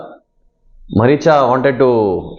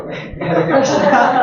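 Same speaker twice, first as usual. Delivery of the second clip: high burst of real laughter; amused laugh; 11 semitones higher than usual. Real, not acted. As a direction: laugh at his own joke. Laughing at his own imagined scene.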